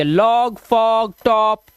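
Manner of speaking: "लॉग, फॉग, टॉप" with a long open aw vowel; pronunciation demo. Shown as a demonstration. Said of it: "अ हो जाएगा".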